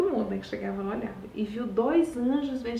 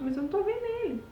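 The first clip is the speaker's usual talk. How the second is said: frightened disciple; 11 semitones higher, much faster than usual. Retold or imitated. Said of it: "aterrori-, atemorizado".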